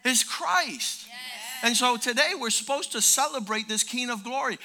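ordinary talk, nothing marked

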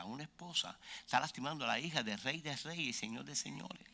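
soft, low pastoral voice; hushed, serious exhortation; earnest gentle appeal. Real, not acted. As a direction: bring it down to a quiet, sincere close. Earnest closing appeal of the sermon.

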